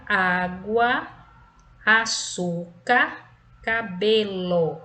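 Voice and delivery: "água, açúcar, cabelo" slowly, stressing Á, ÇÚ, BE; teacher's stretched "água, açúcar, cabelo", next-to-last syllables strong; stress on penultimate syllables. Shown as a demonstration. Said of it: "paroxítonas é a penúltima".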